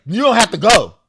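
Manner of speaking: high, loud objection. Retold or imitated. Boyfriend telling him he need not leave, loud and high. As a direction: push back hard, loud and high.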